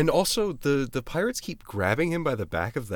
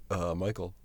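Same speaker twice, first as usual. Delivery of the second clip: low, quiet, uncaring call; indifferent; 7 semitones lower, 11 dB quieter than usual. Shown as a demonstration. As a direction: say his name without caring. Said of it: "he's like".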